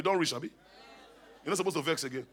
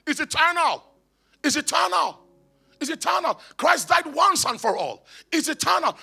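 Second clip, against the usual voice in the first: high-pitched preaching in emphatic bursts; exultant proclamation; 9 semitones higher, 7 dB louder than usual. Real, not acted. Preacher declaring grace eternal to church, high proclamation. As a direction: proclaim each phrase, dropping at its end.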